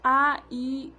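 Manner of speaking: demonstrating long vowel sounds for the class. Shown as a demonstration. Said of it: "वे स्वर दीर्घ स्वर कहलाते हैं".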